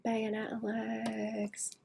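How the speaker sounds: slow breathy whisper. Shown as a demonstration. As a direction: breathe the words out slowly, no voice. Repeats phrase, second time whispered.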